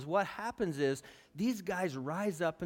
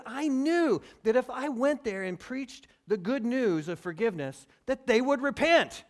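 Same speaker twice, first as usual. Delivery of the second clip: whiny complaint; 8 semitones higher, 8 dB louder than usual. Retold or imitated. Jonah complaining to God.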